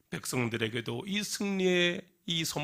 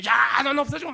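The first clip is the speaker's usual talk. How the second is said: higher, louder angry yell; bigger curse; 13 semitones higher, 9 dB louder than usual. Acted. Upset girl yelling a bigger curse off the mountain. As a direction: scream the curse harder. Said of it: "속상해서 막 더 큰".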